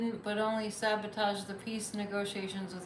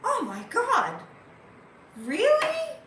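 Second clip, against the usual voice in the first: mock shock; 12 semitones higher, 6 dB louder, slower than usual. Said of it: "you'd be like".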